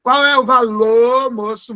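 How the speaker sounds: loud "valor" as "valô", long circumflex O; R dropped. Shown as a demonstration. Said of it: "como se fosse um circunflexo no O".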